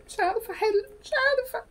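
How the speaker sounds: high whimpering schoolgirl voice; whiny helpless student. Acted. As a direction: beg for pity like a lost pupil. Student acting helpless in exam.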